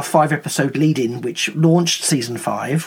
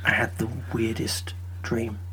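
hushed dreamy whisper; hazy puzzled dream recounting; 3 semitones lower, 7 dB quieter than usual. Acted. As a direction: whisper it like half-awake from a dream. Dreamy whispered skit opening.

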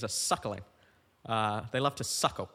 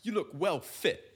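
mock British slang; 5 semitones higher than usual. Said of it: "such a weird thing to say".

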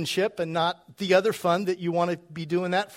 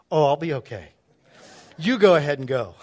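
wife's breezy send-off; 5 semitones lower, 3 dB louder than usual. Retold or imitated. Wife telling husband he can go away for the weekend, mock-reassuring. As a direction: lower mock-wife voice, lightly put on. Imitating wife glad to see him leave.

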